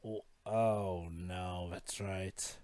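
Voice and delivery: hushed realization. Real, not acted. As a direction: drop the voice as it dawns.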